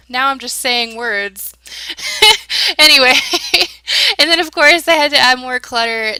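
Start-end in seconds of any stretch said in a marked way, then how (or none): 1.7-2.7 high, bright giggle. Real, not acted. Builder laughing at her own rambling, high giggle. 3.2-4.1 high, bright giggle. Real, not acted. Builder laughing at her own rambling, high giggle.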